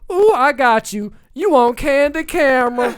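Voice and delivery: mock scared lady, slow and loud; scared retail lady. Filmer teasing a SWAT officer, loud mock scared lady. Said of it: "I sounded like a scared".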